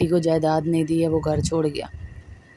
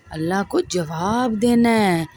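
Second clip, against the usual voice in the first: slow; slower than usual. Real, not acted. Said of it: "solemn slow emphasis".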